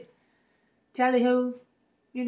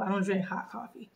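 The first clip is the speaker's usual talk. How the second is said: normal talking voice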